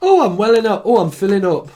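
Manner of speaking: high, loud mock weepy voice; mock tearful gush. Psychic mocking himself welling up, high and hammed-up. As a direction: ham up being moved to tears.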